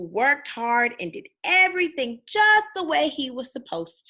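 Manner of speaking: put-on lively read-aloud for kids, bouncy tune; storybook reading. A narrator's reading. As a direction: read it like bedtime story time.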